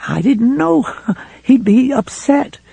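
a scared wife breaking toward tears; fretful and frightened. A narrator's reading. Helen telling Ralph about Ed's return, frightened and rising. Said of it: "becoming fretful and frightened".

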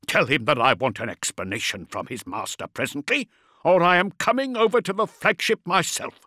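holding back a shout; low and furious. A narrator's reading. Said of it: "his voice low and furious".

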